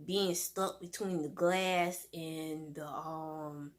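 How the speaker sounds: ordinary talk, nothing marked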